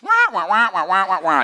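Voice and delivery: high, loud muted-trumpet wah wah; adult gibberish. Retold or imitated. The mom's soothing words as a scared kid heard them, mock wah-wah gibberish. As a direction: mimic grown-up talk a kid doesn't hear. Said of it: "It sounded a lot like this".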